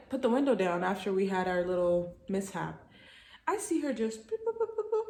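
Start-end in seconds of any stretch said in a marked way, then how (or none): 3.5-5.0 boop sounds. Retold or imitated. Mimicking her walking off.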